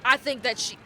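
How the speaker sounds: ordinary delivery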